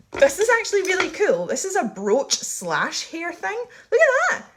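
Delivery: high, bright gush over a find; excited. Real, not acted. To viewers showing her vintage brooch, excited squeal.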